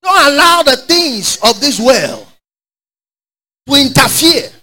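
high, slow and pressing; high urgent exhortation. Real, not acted. Preacher slowly urging the church to hold on. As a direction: urge them not to let go.

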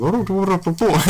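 high-pitched put-on Indian accent; mock Indian accent. Joining in with friends, mock Indian accent. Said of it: "making an Indian accent".

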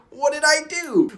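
high, loud mock protest; playful what-did-I-do; mock surprise. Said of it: "I'm like".

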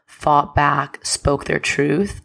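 ordinary talk

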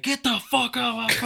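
sudden high, very loud yell; drill instructor shout. Retold or imitated. Drill instructor turning on him as a recruit passes, furious imitated shout. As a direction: explode into a drill instructor's bawl.

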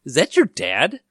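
a hint of a child asking; imitated kid. Kid turns to his trick-or-treating friend, lightly imitated child. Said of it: "one kid turns to him and goes".

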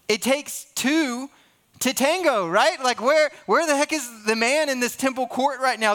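high-pitched, incredulous challenge; indignant. Real, not acted. Indignant at the missing man.

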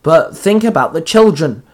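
ordinary delivery

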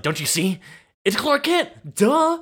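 jumps high on the reveal; hammed-up eager revelation; mock excited. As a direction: imitate giddy insistence, climbing in pitch. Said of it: "Lionel's so excited".

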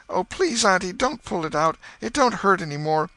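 put-on frightened child begging off. A narrator's reading. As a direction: plead desperately, voice shooting up. Tom begs aunt not to pull.

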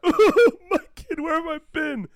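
hammed-up, high, loud cry of a distraught father; mock anguished wail. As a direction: wail it with exaggerated heartbreak. Acts absent father's anguished reunion.

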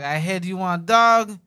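loud, high mock Haitian accent; booming put-on Haitian dad voice. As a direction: imitate a Haitian father laying down orders. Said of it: "My dad was like".

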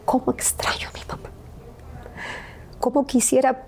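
high, voice giving way to a whisper; thin high grief, nearly voiceless in the middle; real tearful longing. Real, not acted. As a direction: choke up while missing your mother. Tearfully missing her late mother.

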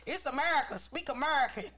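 high, loud indignant rant; mocking angry American. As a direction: play an irate patriot. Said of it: "be like these people and be like".

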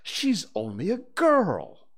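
high, indignant protest; aghast. Acted. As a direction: protest in shocked disbelief.

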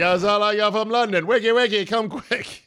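high, put-on London accent; mock British accent. Podcast host quoting a movie bite in London accent.

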